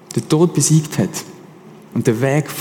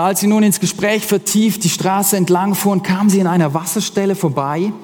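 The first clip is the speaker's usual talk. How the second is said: ordinary delivery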